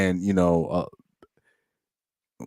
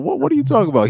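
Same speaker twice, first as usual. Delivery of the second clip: baffled retort; 3 dB louder, much faster than usual. Retold or imitated. Retells baffled reply to commenters.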